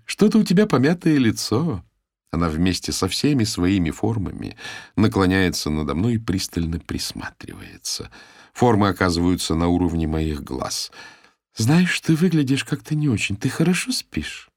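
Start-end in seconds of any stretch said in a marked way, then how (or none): 0.1-1.8 high chirpy voice. A narrator's reading. Kimberli's voice, high. 11.6-14.5 high chirpy voice. A narrator's reading. Kimberli's voice, high.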